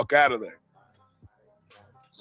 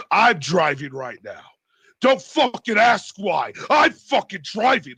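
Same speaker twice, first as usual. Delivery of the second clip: retold angry outburst; 3 semitones higher, 4 dB louder than usual. Driver refusing to explain to passenger, retold angry shouting. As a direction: imitate him snapping forcefully, hitting each word.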